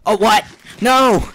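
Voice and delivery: loud, high shocked outburst; shocked yell. Real, not acted. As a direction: yelp in sudden disbelief. Sudden in-game mishap.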